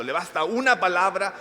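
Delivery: preacher at full fervour; impassioned preaching. Acted. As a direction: lean into every word for the faithful. Preaching climax.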